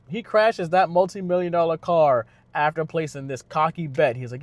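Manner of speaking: ordinary talk, nothing marked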